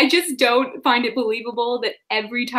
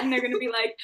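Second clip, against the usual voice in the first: ordinary talk, nothing marked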